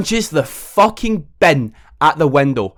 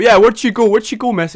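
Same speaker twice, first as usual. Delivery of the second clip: cheeky question; 9 semitones higher, 6 dB louder than usual. Retold or imitated. Cheeky classmate teasing teacher.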